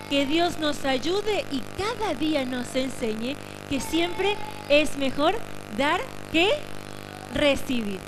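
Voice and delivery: quiet it right down for the end; hushed. Real, not acted. Pastor closes the service gently for the congregation, hushed. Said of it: "Ahora sí, en silencio".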